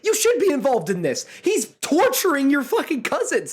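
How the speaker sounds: high, emphatic fed-up protest; exasperated scolding. Retold or imitated. Host voices frustrated fans scolding the Rock, high exasperation.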